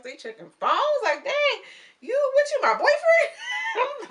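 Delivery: loud, high, slow cackling disbelief; laughing outrage. Real, not acted. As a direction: howl it out, laughing in mock outrage. Mock outrage at phone checks.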